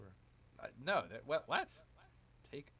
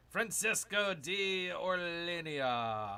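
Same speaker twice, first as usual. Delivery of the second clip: loud, slow, drawn-out fanfare; grand announcement; 4 semitones higher, 6 dB louder, much slower than usual. Pretend. Streamer presenting his new conquistador, grand announcer style. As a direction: proclaim the name, stretching the last syllable.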